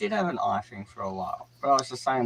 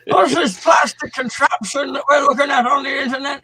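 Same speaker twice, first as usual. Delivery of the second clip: put-on high, shouting old geezer; old man voice; 13 semitones higher, 5 dB louder than usual. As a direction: yell like a confused old man. Mocking old man bit.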